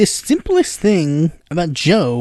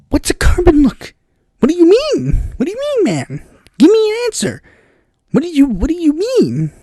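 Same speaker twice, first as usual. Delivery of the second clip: much higher, pleading bafflement; exasperated disbelief; 10 semitones higher than usual. Acted. Baffled viewer questioning Biden's nonsense, exasperated. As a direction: demand an answer in squeaky mock exasperation. Acted exasperated reply to muddled quote.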